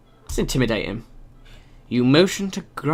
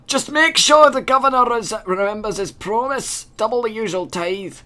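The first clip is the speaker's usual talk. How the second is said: put-on character voice; 7 semitones higher than usual. Voicing a character.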